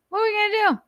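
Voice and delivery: very high-pitched silly question; squeaky mock voice. Same question in two voices.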